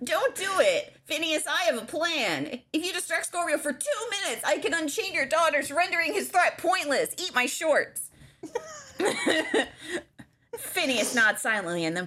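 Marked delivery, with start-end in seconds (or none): 0.0-8.1 high cartoon boy, all the way through; Bart Simpson. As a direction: read it as a cartoon schoolboy. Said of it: "Can anyone do a Bart?".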